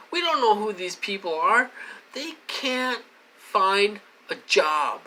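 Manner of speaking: exasperated rant. Real, not acted. Ranting about the perps.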